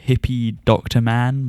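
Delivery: usual speaking voice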